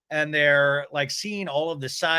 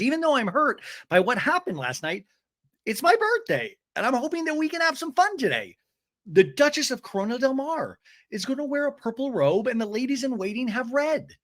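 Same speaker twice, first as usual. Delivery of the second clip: high-pitched imitation of a hopeful speech; mimicked birthday hostess; 9 semitones higher than usual. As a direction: play her pitched up, announcing the costumes. Voices Shannon's birthday speech.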